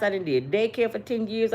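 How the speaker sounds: normal talking voice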